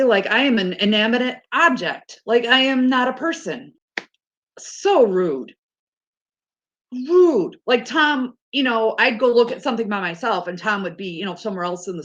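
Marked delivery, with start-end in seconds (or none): 4.6-7.6 indignant outburst. Real, not acted. Indignant about rude strangers.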